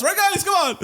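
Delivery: very high, goofy call-out; rallying the class. Retold or imitated. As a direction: egg on the classmates in a squeaky mock voice. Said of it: "I get up in the front of the class".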